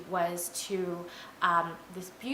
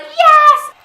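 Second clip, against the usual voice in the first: very high, very loud scream; excited cheer; 20 dB louder than usual. Retold or imitated. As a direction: shriek it like a whole rowdy group cheering. Group cheers at guide's alcohol offer.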